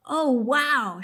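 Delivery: thrilled exclamation at the deer in the meadow; exclaimed. Acted. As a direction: burst out with delight at the view. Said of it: "she exclaimed".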